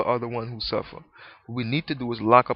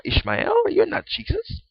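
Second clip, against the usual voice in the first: much higher, louder mock denial; mock shout; 5 dB louder than usual. Doubter shouting down Jesus, higher louder mock yell.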